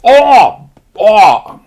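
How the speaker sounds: high, loud mock oohs; excited pilots. Pilots hearing the promise, high loud mock oohs. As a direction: whoop it like thrilled recruits.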